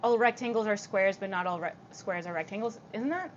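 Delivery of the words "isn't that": usual speaking voice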